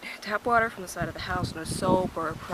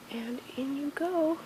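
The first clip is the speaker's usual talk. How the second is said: high, slow whisper; 8 semitones higher, 7 dB quieter, slower than usual. Real, not acted. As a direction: breathe it out softly. Lowering bones into bucket.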